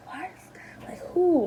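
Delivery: hushed, breathy whisper of confusion; whispered disbelief. Retold or imitated. Visitor in the bright last room, whispering in disbelief. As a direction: whisper it like you can't believe it. Whispered inner thought in museum.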